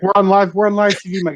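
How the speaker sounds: panicked host. Retold or imitated. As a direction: plead like a nervous TV host. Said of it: "And he's like".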